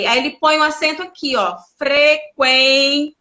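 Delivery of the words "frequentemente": frequentemente chopped slowly, every syllable distinct. Shown as a demonstration. Teacher showing where the accent goes, frequentemente syllable by syllable. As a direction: count out fre-quen-te-men-te deliberately. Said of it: "Uma palavra com cinco sílabas".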